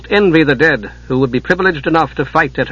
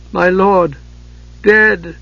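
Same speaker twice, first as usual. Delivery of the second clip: rising, near-sobbing grief, higher; almost a sob; 5 semitones higher, much slower than usual. Acted. Commander telling his men their lord is dead, near sob. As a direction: voice nearly breaking on the words. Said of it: "he spoke with almost a sob".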